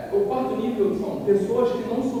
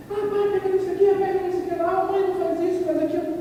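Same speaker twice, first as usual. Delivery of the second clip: put-on much higher, childish nagging; whiny kids; 11 semitones higher, faster than usual. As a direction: play the kids pestering their parents. Children nagging during packing. (crianças pedindo tudo aos pais em voz aguda)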